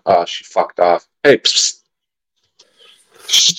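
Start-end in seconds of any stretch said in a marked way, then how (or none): 1.2-3.6 unvoiced hiss to call a cat; psst for attention. Real, not acted. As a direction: whisper psst to get the cat's attention. Calling the cat.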